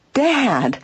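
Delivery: high, startled call in disbelief; disbelieving cry. A narrator's reading. Daughter finds her father is the burglar, crying out in disbelief. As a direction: cry out as if you can't believe it. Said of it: "cried Nancy in disbelief".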